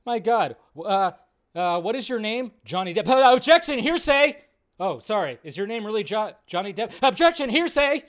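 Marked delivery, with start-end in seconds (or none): 3.1-4.4 loud, high hammed-up objection; mock lawyer objecting. Streamer mocking the lawyer, loud hammed-up objection. Mock cross-examination skit. 7.0-8.0 loud, high hammed-up objection; mock lawyer objecting. Streamer mocking the lawyer, loud hammed-up objection. Mock cross-examination skit.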